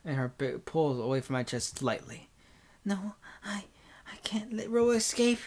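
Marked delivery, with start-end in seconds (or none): 2.8-5.4 put-on high, strained girlish protest; distressed girl. Senpai refusing to let Roa escape, distressed high voice.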